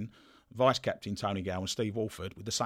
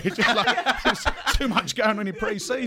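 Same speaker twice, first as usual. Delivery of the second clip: laughing; 12 semitones higher, 6 dB louder than usual. Real, not acted. Laughing at pre-season excess.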